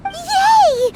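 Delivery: high, loud delighted yay; happy cheer. Acted. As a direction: cheer like a kid promised a treat. Paimon cheers at free dinner.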